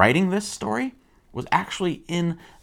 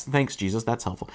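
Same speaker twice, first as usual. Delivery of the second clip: low, soft sarcastic aside; underplayed mock gratitude; dry sarcasm; 5 semitones lower, 7 dB quieter than usual. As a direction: mutter unimpressed thanks.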